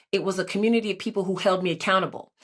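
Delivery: usual speaking voice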